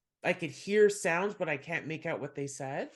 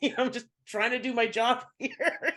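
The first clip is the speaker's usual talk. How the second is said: laughing; 8 semitones higher than usual. Real, not acted. Laughing while recounting.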